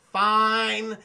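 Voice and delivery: a loud, exasperated give-in; grudging fine. Retold or imitated. Marsden giving in to Sonic, loud and fed up. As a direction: bark it out, finally giving up. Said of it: "James Marsden's like".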